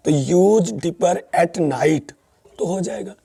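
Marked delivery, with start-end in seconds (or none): 0.1-2.1 example read very slowly; teacher reading an example slowly; slow example sentence. Shown as a demonstration. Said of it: "इसी को हम use करें एक वर-, अ, sentence में".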